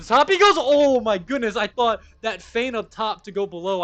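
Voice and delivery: loud, high burst, easing off towards the end; real commentator hype at full volume; excited play-by-play. Real, not acted. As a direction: erupt at the big play. Commentator erupts at a feint.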